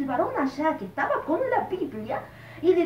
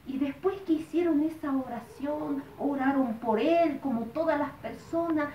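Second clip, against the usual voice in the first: normal talking voice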